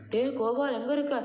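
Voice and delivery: high-pitched searching call. Pretend. Player hunting for missing baby Gopal, high calling out.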